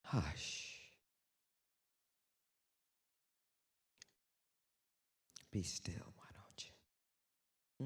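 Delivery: low, soft, slow whispered hush. Shown as a demonstration. Whispering to the audience to be still and listen for God. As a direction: hush them like a meditation guide. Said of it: "You wanna hear the voice of God?".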